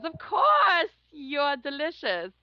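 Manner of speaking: high, loud, then slow and savoring; smug suitor impression. Rich client flattering her over dinner, high and smug. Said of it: "He goes".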